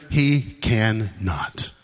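slow, deliberate stress on "cannot"; emphatic CANNOT. Real, not acted. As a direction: land "cannot" slowly, as a final low verdict. Preacher hammering home what God cannot do.

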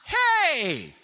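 put-on very high, loud little boy crying out; preacher imitating his grandson's delighted yell; excited toddler shout. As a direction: shout it like a thrilled three-year-old. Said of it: "we heard him yell out".

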